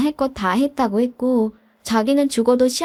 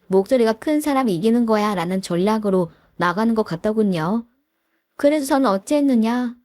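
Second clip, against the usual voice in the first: plain reading voice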